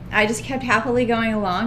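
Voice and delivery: ordinary talk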